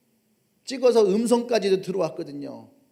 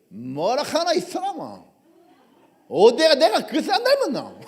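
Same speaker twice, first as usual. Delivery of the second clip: Gyeongsang dialect, rising and louder with a laugh; Gyeongsang dialect denial; 4 semitones higher, 5 dB louder than usual. Acted. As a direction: Peter protests in Gyeongsang dialect. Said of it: "베드로가 사투리를 쓰는 거야". (경상도 사투리로 웃으며 부인)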